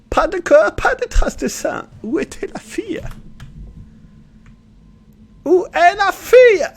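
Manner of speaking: very high, loud mock German accent. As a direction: ham up a German accent, high-pitched and strained. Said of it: "Je vais essayer l'accent allemand". (accent allemand caricatural, très aigu et fort)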